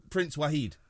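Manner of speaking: normal talking voice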